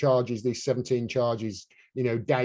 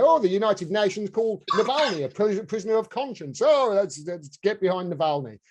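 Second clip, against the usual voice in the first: high, fussy mock enthusiasm; mocking mimicry; 7 semitones higher than usual. Voicing those who rally behind Navalny, mocking mimicry. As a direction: mimic their eager endorsement, pitched up.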